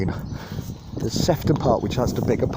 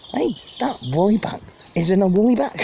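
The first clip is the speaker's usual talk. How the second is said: high, louder Scouse jeer; mock a non-local in thick Scouse; broad Scouse; 6 semitones higher, 3 dB louder than usual. Mates jeering at an outsider in broad Scouse. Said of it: "We talk a bit like that".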